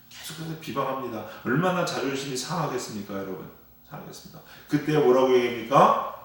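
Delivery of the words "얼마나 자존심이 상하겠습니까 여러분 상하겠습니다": usual speaking voice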